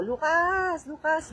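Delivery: high mock yell for someone upstairs; calling out. Parent calling a child on the second floor, imitated high yell. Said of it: "sumisigaw ako".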